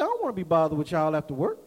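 low, quick, blunt refusal; deadpan low and fast brush-off; raw, unsugared. Retold or imitated. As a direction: say it raw, no sugarcoating. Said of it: "I was just that raw".

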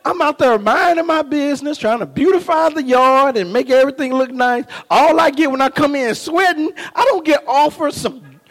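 normal talking voice

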